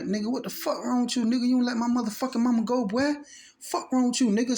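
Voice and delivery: high, fast, angry yelling at a grown man; furious retold yell. Kid screaming at mom's boyfriend choking her, angry and high.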